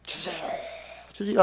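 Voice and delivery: soft, breathy mock-tearful begging; weeping plea. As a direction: imitate someone pleading through tears. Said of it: "울고 불고".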